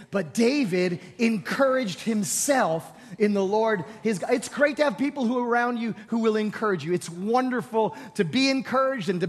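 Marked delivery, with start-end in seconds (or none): 0.1-4.3 slow, lined-out reading together; congregation reading together. Shown as a demonstration. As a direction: read it out slowly with the people. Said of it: "Say it with me, ready?".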